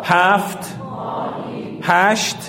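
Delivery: teacher counts aloud for the class; loud group count. Shown as a demonstration. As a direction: call each number out to the class. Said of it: "همه با هم با صدای بلند".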